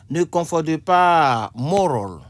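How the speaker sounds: loud slow classroom heading; emphatic announcement. Shown as a demonstration. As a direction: call out the heading like a teacher. Teacher announcing each word pair.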